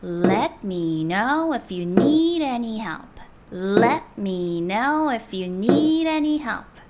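very slow, clear English model; slow model reading. Shown as a demonstration. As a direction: read slowly for learners to copy. Model sentences for learners. (천천히 또박또박 읽는 예문)

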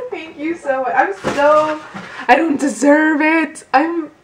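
high, emotional thanks; overwhelmed with gratitude. Real, not acted. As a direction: gush it, overcome and undeserving. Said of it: "I'm just so thankful".

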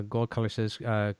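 ordinary talk, nothing marked

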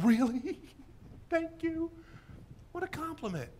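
high, softened, delighted thanks; mock flattered. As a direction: act thrilled by an insult as if praised. Acts flattered by being called odd.

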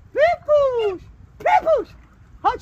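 sing-song pet call up high; high-pitched call. Real, not acted. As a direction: call the duck's name high and bright. Calling the pet duck.

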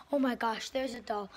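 frightened loud cry; scared shout. Real, not acted. Spotting the creepy doll.

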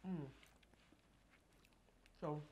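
ordinary talk

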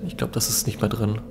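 hushed whisper. Real, not acted. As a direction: whisper it under your breath. Quiet aside while sneaking.

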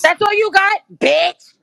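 high, goading mockery; taunting. Real, not acted. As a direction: goad her, mocking. Taunting opponent in argument.

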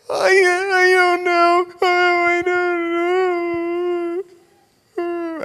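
gasping high, loud wail; hammed-up tearful child, words stretched out; sobbing small child. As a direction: blubber through caught breaths like a little boy. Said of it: "when he draws breath".

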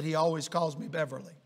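low, soft retold aside; quiet aside. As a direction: drop the voice for a confiding aside. Hushed aside inside retold prayer.

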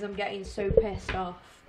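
ordinary talk

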